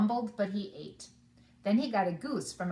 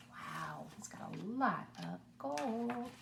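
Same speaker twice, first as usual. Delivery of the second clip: hushed aside; 4 semitones higher, 8 dB quieter than usual. Real, not acted. Reader aside to children.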